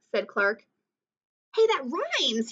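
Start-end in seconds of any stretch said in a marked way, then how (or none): 1.5-2.5 cried out. A narrator's reading. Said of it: "he cried".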